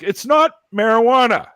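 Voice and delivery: high, exasperated mock shout; retold loud protest. Teen setting yelling parents straight, retold loud protest. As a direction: insist at the top of your voice.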